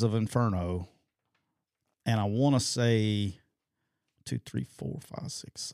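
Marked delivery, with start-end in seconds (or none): 4.2-5.7 whispered counting. Real, not acted. Counting cases under breath.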